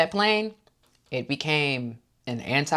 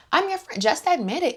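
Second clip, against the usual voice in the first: much higher, pushy sing-song coaxing; hammed-up Heather; 13 semitones higher than usual. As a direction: overdo the fake friendship.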